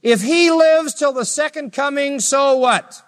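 high, sharp dismissive retort; voicing Jesus. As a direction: brush off the question. Jesus rebuking Peter.

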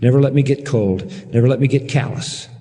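earnest, measured prayer with long pauses; closing prayer. Real, not acted.

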